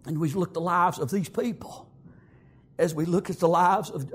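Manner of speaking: usual speaking voice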